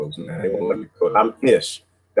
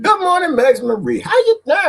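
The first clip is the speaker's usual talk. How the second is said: high, sing-song hello to a viewer; high greeting. Real, not acted. Calling out good morning to a viewer in a high voice. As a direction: greet her in a bright high call.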